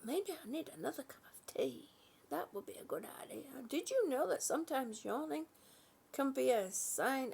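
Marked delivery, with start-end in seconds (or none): 2.3-3.4 hushed whisper to herself; fast whisper under her breath; whispered aside. Real, not acted.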